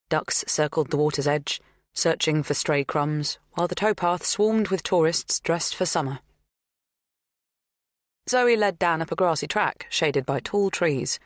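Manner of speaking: usual speaking voice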